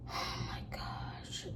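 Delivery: slow, barely audible imitated gasp; exasperated whisper. Mom reacting to her child's complaint, slow exasperated whisper. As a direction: whisper it slowly, acting aghast.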